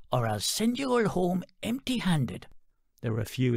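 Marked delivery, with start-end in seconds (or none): 0.1-2.5 stern chicken lady. Acted. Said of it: "the chicken lady snapped".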